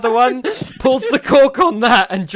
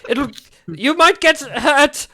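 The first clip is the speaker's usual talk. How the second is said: higher, pleading, slowing down; anxious shopkeeper; 6 semitones higher than usual. Acted. Shopkeeper begging the customers not to touch, high and anxious. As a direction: warn them off nervously. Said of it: "just like".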